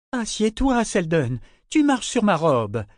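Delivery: high-pitched, irritated scolding. A narrator's reading. Mother tells small boy off her dress, irritated. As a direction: scold a child, dragging the last words. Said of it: "fit-elle irritée".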